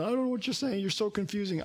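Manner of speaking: preacher teasing the listener who is lost; mock confused listener. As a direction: play a puzzled listener, lightly. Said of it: "he just goes".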